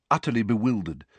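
ordinary delivery

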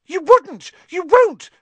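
high, loud panicked gasp. Acted. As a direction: gasp it in terror. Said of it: "gasped Pettigrew".

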